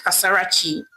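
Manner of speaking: ordinary delivery, nothing marked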